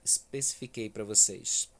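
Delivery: ordinary delivery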